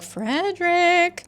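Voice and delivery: high, stretched-out breathy name; breathy warning. Retold or imitated. Jovi warns Frederick of Acker, breathy and stretched. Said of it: "comes out breathier than intended".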